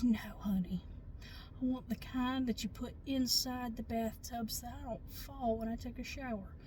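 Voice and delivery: put-on soft, hushed little old lady; breathy whisper of a sweet old lady. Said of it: "she goes".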